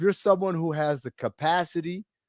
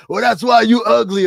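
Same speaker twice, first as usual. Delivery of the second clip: loud, higher retort; imitated yelling; 7 semitones higher, 9 dB louder than usual. Homeless person insulting a passerby, mock yelling. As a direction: mimic a street heckler's yelled insult. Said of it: "who be yelling at you".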